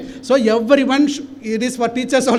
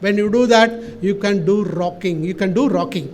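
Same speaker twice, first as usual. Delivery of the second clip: ordinary talk, nothing marked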